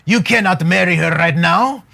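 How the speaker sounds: mock-stern advice in a Cameroonian French accent; thick French African accent. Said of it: "this real thick French African, uh, accent".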